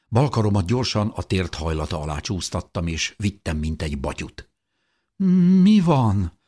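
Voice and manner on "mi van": higher, louder bewildered question; confused question. A narrator's reading. Weak teenager asks the narrator what is happening, confused. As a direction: ask in startled confusion. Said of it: "kérdezte zavartan".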